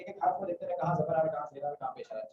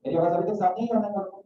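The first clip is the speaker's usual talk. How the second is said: calling out; 4 semitones higher than usual. Real, not acted. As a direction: project to check the remote students can hear. Checking online students can hear.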